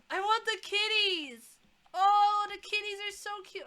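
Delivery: high, loud, gushing over the kitties; excited squeal. Real, not acted. Gamer sees cute kitties and squeals with delight.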